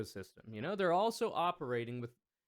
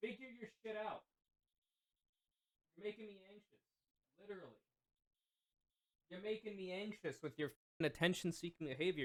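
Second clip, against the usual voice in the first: soft, high prissy complaint; mock annoyed scolding; 5 semitones higher, 10 dB quieter than usual. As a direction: quietly nag the chat for attention-seeking. Scolding chat in a put-on voice.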